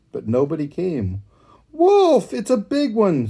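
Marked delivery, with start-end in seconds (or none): 1.7-3.3 very high, loud scream; panicked high shout. A narrator's reading. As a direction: scream a desperate warning. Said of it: "screamed the boy".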